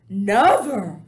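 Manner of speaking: loud, passionate exclamation; passionate cry. Acted. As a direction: cry it out with total conviction. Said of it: "cried the B F G passionately".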